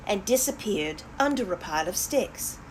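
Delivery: usual speaking voice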